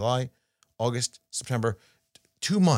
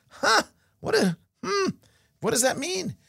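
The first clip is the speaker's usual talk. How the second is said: much higher, quizzical muttering to yourself; puzzled inner voice. Retold or imitated. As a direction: play a baffled listener scratching their head. Imitating a puzzled listener's thoughts.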